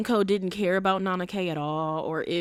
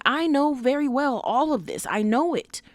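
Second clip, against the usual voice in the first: higher, insistent quoting of Nana K's inner voice; emphatic Nana K; 6 semitones higher than usual. Retold or imitated. Quoting Nana K's inner monologue to viewers, emphatic. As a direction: press the line home as her self-aware thought. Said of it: "Nana K is saying".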